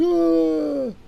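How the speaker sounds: high, loud crowd groan "Ah". Retold or imitated. The bar crowd groans as he quits. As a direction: sound the whole crowd going ah.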